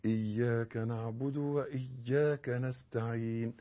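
slow, soft chanted recitation; Quran recitation. Shown as a demonstration. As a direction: recite it gently, word by word. Recites slowly and softly.

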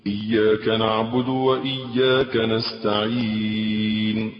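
slow, sung recitation with pauses. Shown as a demonstration. Showing worshippers the right way, slow and chanted. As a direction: chant it slowly, letting each verse rest. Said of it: "وإنما قول".

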